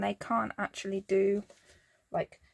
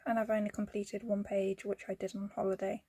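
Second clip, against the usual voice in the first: usual speaking voice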